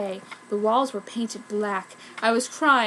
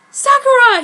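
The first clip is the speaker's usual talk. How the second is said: very high, loud shocked call; 12 semitones higher, 10 dB louder than usual. A narrator's reading. Ryo walks in on Sakura with Kishu, shocked. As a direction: cry her name in dismay.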